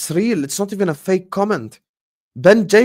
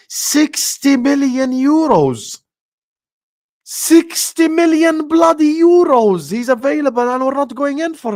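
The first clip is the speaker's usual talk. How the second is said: exasperated yell; 7 semitones higher, 4 dB louder than usual. Real, not acted. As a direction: shout it up at the sky in disbelief. Raging at the club's inaction.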